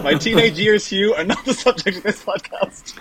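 high, laughing protest. Real, not acted. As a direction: laugh through the words. Laughing reply to sex joke.

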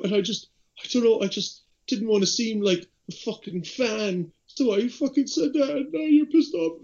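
put-on high, wailing drunk fan, rising in pitch; hammed-up tearful apology; mock crying apology. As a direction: whine it out like a weepy drunk fan. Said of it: "comes back up to me crying".

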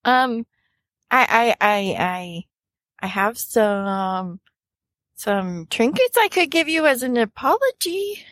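stammered, halting peace offering; nervous, hesitant character voice; flustered fumbling. Acted. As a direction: stumble toward a tentative apology. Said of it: "she's fumbling over her words".

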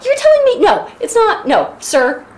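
high, loud disbelieving protest; indignant. Real, not acted. Podcaster objecting to Gulliver's excuse, indignant. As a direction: challenge it, incredulous.

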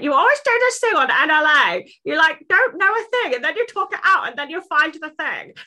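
high, loud mock friend voice; high-pitched impression of Helen. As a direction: squeak it out like a teasing friend. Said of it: "Was that an impression?".

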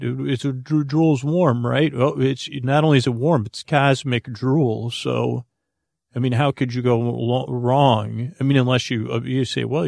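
usual speaking voice

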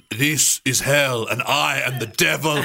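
slow, shouted villain line; menacing gang member. Acted. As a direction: growl it out like a movie villain. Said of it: "One of them says".

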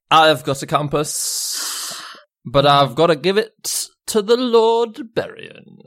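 put-on slow, slurred drunk woman; slow tipsy drawl. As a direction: drag it out tipsily. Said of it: "She's like".

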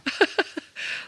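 high, breathy burst of laughter. Real, not acted. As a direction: laugh at a friend's joke. Listener laughs after the joke.

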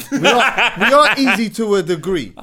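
laughing through the words. Real, not acted. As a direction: giggle it out loud. Laughing while admitting it.

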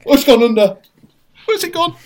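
frantic search, voice jumping up; high startled panic; panicked, high-pitched. Real, not acted. Spider vanished, panicking.

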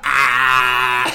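long, loud mock "ah"; drawn-out taunting holler; imitated jeering yell. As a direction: imitate a mate yelling back. Mimics friend jeering out the window.

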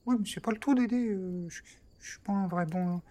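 Trépide's voice. Acted. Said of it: "en faisant cette voix".

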